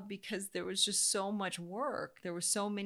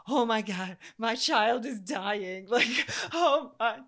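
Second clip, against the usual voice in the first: hammed-up crying panic, higher and much louder, slow; mother retelling her panic at the monitor, hammed-up cry; mock panic; 6 semitones higher, 10 dB louder, slower than usual. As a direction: play up the terror, half laughing. Retelling panic over dropping heart rate.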